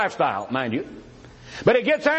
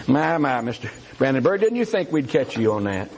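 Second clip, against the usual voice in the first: low, amused, teasing aside; teasing chuckle; 9 semitones lower than usual. Real, not acted. Debater teasing his opponent Brandenburg, low amused chuckle. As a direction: laugh it off at your opponent, low.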